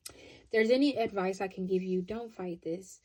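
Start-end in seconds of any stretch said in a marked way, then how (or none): 2.0-3.0 soft, slow and hushed; a quiet, slow reassurance; gentle plea. Real, not acted. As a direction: ease them into letting go. Earnest advice to the viewer.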